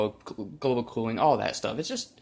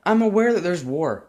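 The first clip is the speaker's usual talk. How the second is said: higher, louder exasperated outburst; 6 semitones higher, 5 dB louder than usual. Real, not acted. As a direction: throw it out, fed up and louder. Exasperated rant.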